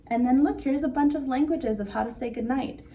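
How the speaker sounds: ordinary delivery, nothing marked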